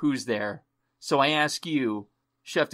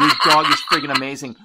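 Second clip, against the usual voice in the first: loud, fast gushing; excited; 10 dB louder, faster than usual. Real, not acted. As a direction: gush about the dog. Enthusing about her dog.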